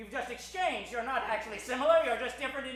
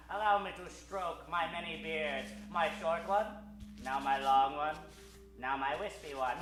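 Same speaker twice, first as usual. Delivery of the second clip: gloating villain; 6 semitones lower than usual. Acted.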